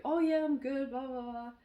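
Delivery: high, airy brush-off trailing into blahs; light, dismissive mock reply that tails away; breezy brush-off. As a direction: imitate a friend glossing over things. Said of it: "he said, "How are you?".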